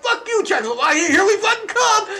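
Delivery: high, loud jeering battle cry; mocking Poles shouting as they invade; mock war cry. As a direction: yell it like an invading mob. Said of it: "the Polish people are like".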